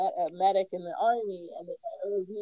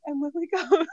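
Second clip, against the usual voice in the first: ordinary delivery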